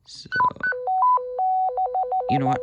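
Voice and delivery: normal talking voice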